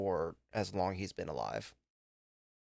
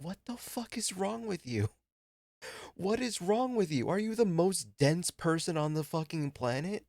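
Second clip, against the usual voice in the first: much higher-pitched, indignant disbelief; exasperated outburst; 10 semitones higher than usual. Retold or imitated. Daughter arguing with her dad over a sad show, exasperated. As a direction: retell an incredulous tirade, voice pitched way up.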